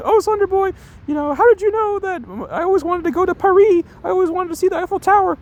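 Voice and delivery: very high swooning falsetto; dumb girl voice. Retold or imitated. Streamer acting out his crush swooning over Paris in falsetto. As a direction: gush it in a high dreamy falsetto. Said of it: "little dumb voices like that".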